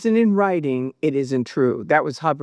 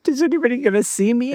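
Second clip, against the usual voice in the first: quick, higher mock-paranoid aside; furtive worry; 6 semitones higher, 4 dB louder, much faster than usual. Telling how she checked for onlookers, mock-furtive. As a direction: act out checking nobody is watching, quick and high.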